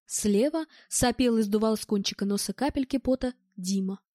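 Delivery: ordinary delivery, nothing marked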